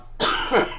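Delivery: short dry cough. Real, not acted. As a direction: cough once and clear the throat. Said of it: "please excuse me again".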